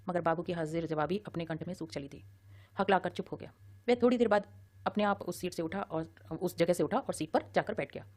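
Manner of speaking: usual speaking voice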